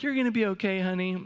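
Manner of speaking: high, sugary reassurance; mock sweet mom. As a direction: coo it like a doting mother. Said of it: "she wasn't like".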